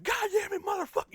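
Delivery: high, loud angry swearing; old angry outburst. Shown as a demonstration. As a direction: blow up cursing at the world. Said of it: "that's how I used to get pissed off at shit".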